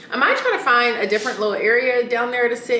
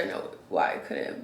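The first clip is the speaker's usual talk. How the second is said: whispered aside; 9 dB quieter than usual. Real, not acted. Quick aside under breath.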